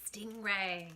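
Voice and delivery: ordinary delivery, nothing marked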